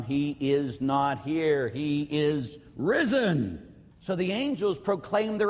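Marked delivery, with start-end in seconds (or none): 0.0-3.7 slow, solemn angel proclamation. Acted. Angels announcing the resurrection, slow and solemn. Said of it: "When the angels come".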